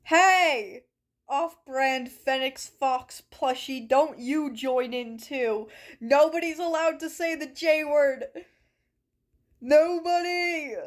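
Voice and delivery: mock scolding. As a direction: lay down the law, half joking. Scolding a plushie and chat.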